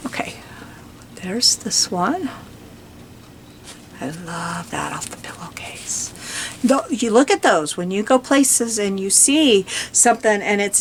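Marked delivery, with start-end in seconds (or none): 0.1-6.1 whispered. Real, not acted. Murmuring while showing pages.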